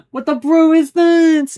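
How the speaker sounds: high, loud, drawn-out disbelief with a laugh; amazed outburst. Real, not acted. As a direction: cry out slowly in amused shock. Amazed reaction to the game.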